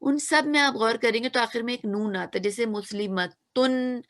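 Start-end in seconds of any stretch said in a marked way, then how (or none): 2.7-4.0 मुस्लिमतुन said slowly so the final नून rings clearly; teacher spells out मुस्लिमतुन with a clear ending नून; slow clear demo. Shown as a demonstration. Teacher to students, showing the नून sound, slow clear demo. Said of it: "आप लोग को एक नून की आवाज आ रही है ना".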